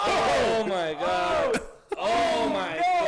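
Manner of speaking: can't believe the finish; stunned shout. Real, not acted. Aftermath of the knockout.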